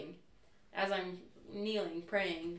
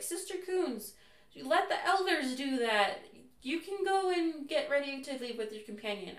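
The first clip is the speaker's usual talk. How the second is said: a higher, lightly voiced president; kind mission president; 7 semitones higher, 3 dB louder than usual. President telling sister to let elders work, lightly voiced. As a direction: gently order her to stop. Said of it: "President Fenn was like".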